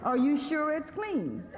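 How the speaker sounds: a helpless husband fussing over a towel; mock whiny husband. Husband complains about towel.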